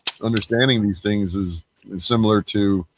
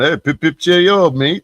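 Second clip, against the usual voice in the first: higher-pitched, hammed-up British accent; mock British yell; 7 semitones higher, 4 dB louder than usual. Man recalling running around England yelling, mock British accent. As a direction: call out cheerily like a caricature Brit. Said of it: "found myself running around yelling".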